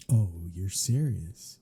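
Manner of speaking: normal talking voice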